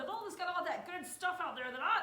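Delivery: high, loud impression of a yeller; Betty Dodson. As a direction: proclaim it in a mock yell. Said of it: "And that's how she talks".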